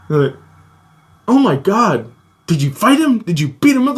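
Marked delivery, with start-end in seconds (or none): 1.3-4.0 high, eager imitated sibling; acted-out amazed curiosity; retold excited questioning. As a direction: pepper with astonished questions, pitched up. Brothers react, acted out in retelling.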